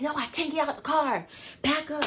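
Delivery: high, loud shout of alarm; mock panicked shout from the other car; retold yell. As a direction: yell it back like the upset driver. Lady yelling at them after crash.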